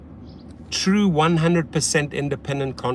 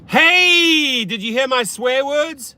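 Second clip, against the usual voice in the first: high, loud, drawn-out holler; stretched "hey", shouted high; calling out; 10 semitones higher, 5 dB louder, slower than usual. Real, not acted. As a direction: holler up to someone at a window. Calling out to wife at window.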